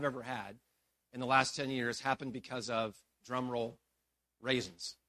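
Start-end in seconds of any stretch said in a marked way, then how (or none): 3.2-5.0 low, soft punchline after a build-up; deadpan reveal. Real, not acted. Preacher confesses cause of his biggest failure, deadpan reveal. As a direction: drop RAISINS low and quiet. Mock drum-roll reveal of the culprit.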